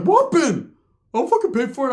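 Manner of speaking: very high falsetto retort; hammed-up high impression; Ricky's high-pitched voice. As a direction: answer back in a squeaky falsetto. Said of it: "He has that high-pitched voice".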